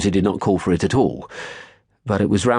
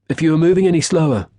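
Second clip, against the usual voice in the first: much higher, louder and quick, a sneering chef; cutting jibe; 11 semitones higher, 4 dB louder, faster than usual. A narrator's reading. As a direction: fire off the insult fast and mocking. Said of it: "he said".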